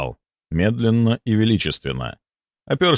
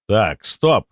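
firm, authoritative halt; loud and clear. A narrator's reading. Doctor stops the humiliation of colleagues, firm and clear. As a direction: cut in clearly, with command. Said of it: "Я произнёс это громко и чётко".

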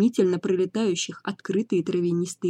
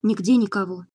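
ordinary delivery, nothing marked

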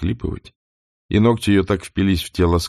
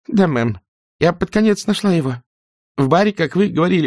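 frightened maid; 11 semitones higher than usual. Acted. Prissy voice.

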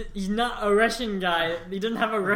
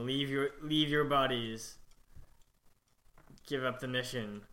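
low, quiet orc negotiator; orc character voice; 5 semitones lower, 10 dB quieter than usual. Acted. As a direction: speak as the orc making an offer.

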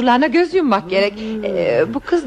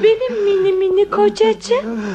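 high-pitched cooing to a pampered husband; babying coo; 7 semitones higher than usual. Acted. Wife fussing over her upset little husband, babying.

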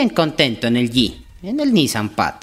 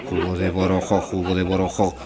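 lower, mocking mother scolding her son; scornful mother; 7 semitones lower than usual. Mother scolds her dim son, lower and scornful. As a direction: sneer it back at him, exasperated.

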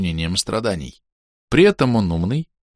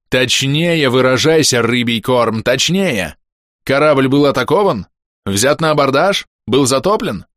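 stern interrogation; 5 semitones higher, 4 dB louder than usual. Acted. Interrogating ghost like officer.

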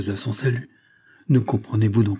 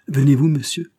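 louder, higher impatient question; feverish impatience; 5 semitones higher, 6 dB louder than usual. A narrator's reading. Woman ready to leave, pressing the agent, feverishly impatient. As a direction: urge him on, impatiently. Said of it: "demanda-t-elle avec une impatience fébrile".

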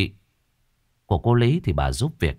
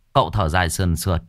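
usual speaking voice

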